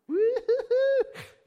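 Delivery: loud, high, hooting squeal of delight; high joyful whoop. Retold or imitated. Pastor reacting to child's news, overjoyed whoop. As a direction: burst out in a high-pitched whoop.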